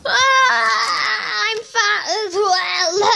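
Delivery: hammed-up high-pitched lament; wailing dismay. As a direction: bawl it out in horror. Character discovers being fat.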